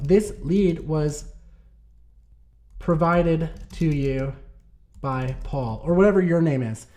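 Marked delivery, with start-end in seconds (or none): 0.0-5.8 slow, word by word, as if typing; slow dictation. Shown as a demonstration. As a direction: spell it out slowly while typing. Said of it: "So I enter in something like".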